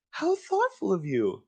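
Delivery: high, slow sarcastic mom voice. Acted. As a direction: drag it out like a mom who isn't fooled. Mom replies to suck-up kid.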